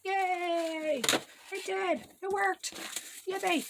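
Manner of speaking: high squeal of joy; excited cheer. Real, not acted. Project finally works, celebrating.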